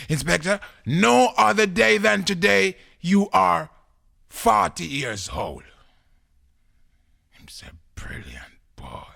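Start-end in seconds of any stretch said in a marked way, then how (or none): 0.1-5.6 loud, high, slow proclamation; proud pupil declaiming. Acted. Pupil proudly announces the age. 7.9-9.1 soft, half-whispered awe; hushed amazement. Acted. Awed inspector in story.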